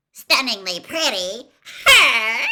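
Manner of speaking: loud, laughing shriek of scorn; mocking shriek at a rival girl. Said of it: "Pansy Parkington had shrieked".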